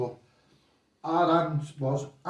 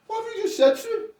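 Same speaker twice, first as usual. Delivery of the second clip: baffled dad; faster than usual. Retold or imitated. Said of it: "His dad looks at me and goes".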